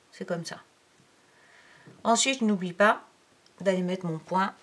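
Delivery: normal talking voice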